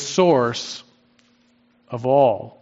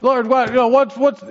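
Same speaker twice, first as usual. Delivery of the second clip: mock complaining; 7 semitones higher than usual. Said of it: "They got angry".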